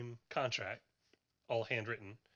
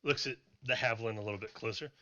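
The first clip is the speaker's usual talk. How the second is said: ordinary talk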